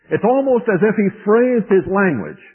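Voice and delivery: normal talking voice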